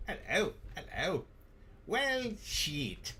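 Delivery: high-pitched mock British voice; British accent. Host obliging a commenter's request, hammed-up British accent. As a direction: put on a squeaky British accent. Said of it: "in your best British accent".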